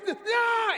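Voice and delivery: high, loud scream cut short; a dying shriek; death cry. Acted. Driver's final scream as he's killed, piercing cry. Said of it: "quickly receding cry".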